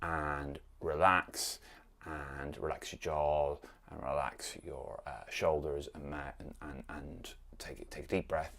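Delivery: slow, soft relaxing voice; soothing guided relaxation, slowing right down; calm relaxation guide. Shown as a demonstration. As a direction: talk them down into calm. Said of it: "stick the tongue ... and relax".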